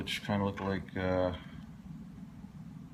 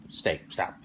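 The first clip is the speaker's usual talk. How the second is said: ordinary talk, nothing marked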